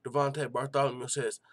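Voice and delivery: ordinary talk, nothing marked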